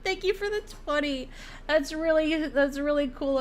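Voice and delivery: high giggly voice; thanking through laughter; laughing while talking. Real, not acted. Thanking donor while still laughing.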